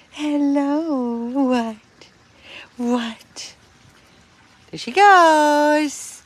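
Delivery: high, drawn-out, gushing hello to a tiny pet. Real, not acted. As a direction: coo over an adored little dog. Cooing at a tiny dog.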